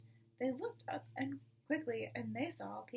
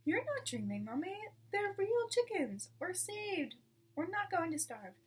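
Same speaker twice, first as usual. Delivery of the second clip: put-on higher, eager child voice; excited small fox; 8 semitones higher than usual. Small fox to weak mother, excited and eager. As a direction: burst out with the good news.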